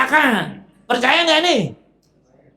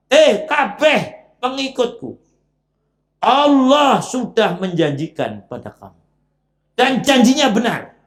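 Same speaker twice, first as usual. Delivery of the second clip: a lightly raised scolding speech; Iblis blaming followers; 4 semitones higher than usual. Acted. Iblis scolding his followers for believing him. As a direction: lecture them, pinning the blame. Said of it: "pidatoni nyalah-nyalahkan lagi".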